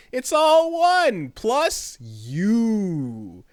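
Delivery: high, loud, stretched-out mock uplift; hammed-up slow, swelling brand sincerity; mock trailer gushing. Host parodying the merger trailer to viewers, mocking gushiness.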